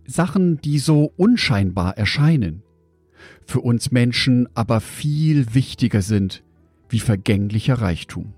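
normal talking voice

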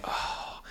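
voiceless breathy "oh"; acted sigh. Storyteller acts out riding past all these people, breathy sigh. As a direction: let out an unvoiced breath of dismay. Acted reaction sitting in open car.

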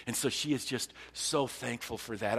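usual speaking voice

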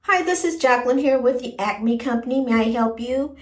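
careful, even office phone greeting; switched-up receptionist voice. Shown as a demonstration. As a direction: put on your professional switchboard voice. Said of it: "I can switch my voice up".

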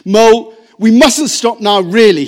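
loud, brisk call over the distance; calling back. A narrator's reading. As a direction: call back over your shoulder, hurrying. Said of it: "he called back".

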